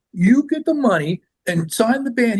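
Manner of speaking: high, forceful mock order; crass lawyer. Crass lawyer to A and R guy, pushy demand. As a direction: imitate a brash lawyer laying down terms. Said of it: "he was this really crass too".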